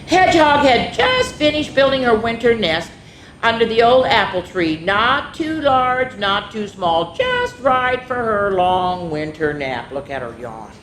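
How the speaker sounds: lively, sing-song storybook voice; reading to children. A narrator's reading. Teacher reading a picture book to daycare kids, lively. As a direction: read it like story time for little ones.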